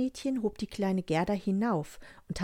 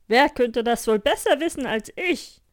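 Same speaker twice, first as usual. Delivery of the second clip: hohe, laute, langsame Tierstimme; schwärme laut und langsam vom Schnee; schwärmendes Rentier; 5 semitones higher, 8 dB louder than usual. Acted. Rentier antwortet stolz. (Rentier schwärmt Gerda laut vom Norden vor)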